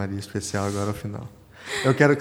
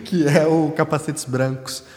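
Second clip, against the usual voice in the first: laughing; 6 semitones higher, 7 dB louder, slower than usual. Real, not acted. Laughs at stolen recommendations.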